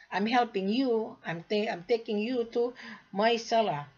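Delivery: ordinary talk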